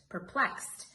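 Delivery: ordinary delivery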